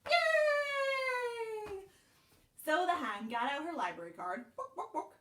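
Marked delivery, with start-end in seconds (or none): 0.1-1.7 high, loud, long-held yay; celebrating cheer. A narrator's reading. Cheer as hen gets books. 4.6-5.1 three quick high clucks of "book"; clucking hen. A narrator's reading. Hen clucks while checking out.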